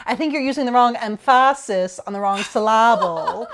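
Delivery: emphasis as em-FASS-is, syllable as sil-LAH-ble; movie quote with stress shifted in emphasis and syllable to the second beat; wrong-syllable stress. Retold or imitated. Quoting a forgotten movie, emphasis as em-FASS-is, syllable as sil-LAH-ble. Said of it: "he goes like".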